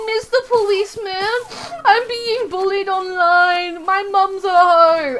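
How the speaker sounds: high, loud, drawn-out mock wail; whining crybaby victim. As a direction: wail it slowly like a tattling child. Acts tattling crybaby to policeman.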